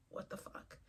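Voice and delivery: breathless whispered disbelief. Retold or imitated. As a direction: whisper it, stunned. Inner reaction to realizations.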